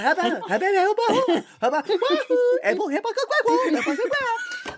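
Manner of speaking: high, giggly mock-French gibberish; French Simlish. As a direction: babble like a French Sim cracking up. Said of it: "it's French Simlish".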